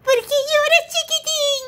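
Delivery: put-on a squeaky rhino asks the crying baby; high squeaky rhino. Rhino asks the crying little elephant, squeaky high voice.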